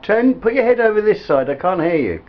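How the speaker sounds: loud, high-pitched call. Real, not acted. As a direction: call out to get them to turn. Calling to companion to turn round.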